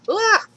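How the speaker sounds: sharp high yelp of fright; startled yelp. Real, not acted. To a sudden mob, short high startled yelp. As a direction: yelp as if jumped at.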